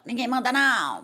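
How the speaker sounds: imitating a bossy, mannish manner; masculinized voice. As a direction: act out a tough 'nobody orders me' attitude. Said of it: "peguei um lado masculinizado". (jeito masculinizado, mandão)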